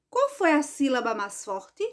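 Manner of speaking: ordinary talk, nothing marked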